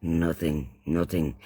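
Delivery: ordinary delivery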